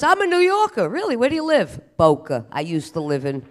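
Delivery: loud, high, boastful New Yorker voice; proud New Yorker. Retold or imitated. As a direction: brag about being from New York. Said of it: "Everybody goes".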